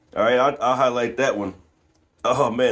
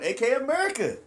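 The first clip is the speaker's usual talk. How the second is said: ordinary talk, nothing marked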